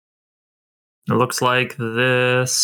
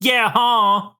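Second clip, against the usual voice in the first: put-on childish retort; 8 semitones higher than usual. Childish bickering back.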